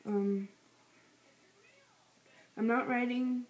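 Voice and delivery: fast, gum in the mouth; chewing gum. Real, not acted. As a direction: mumble through chewing gum. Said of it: "I have gum in my mouth".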